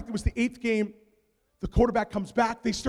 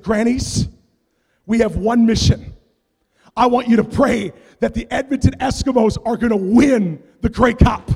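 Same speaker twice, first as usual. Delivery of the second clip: rallying command; 9 dB louder than usual. Retold or imitated. Commanding his prayer army.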